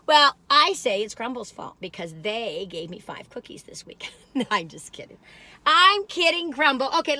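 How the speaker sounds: normal talking voice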